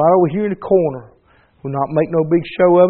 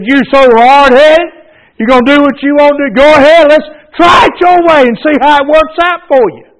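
louder, higher scolding shout of a stern God; God rebuking; 6 semitones higher, 7 dB louder than usual. Retold or imitated. God giving him up to his own way, shouted rebuke. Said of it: "He said".

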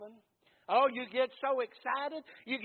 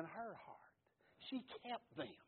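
hushed whisper of awe; 3 semitones lower, 14 dB quieter than usual. Acted. Preacher hushes as Mary keeps her treasures, whispering. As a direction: drop to a breathy secret.

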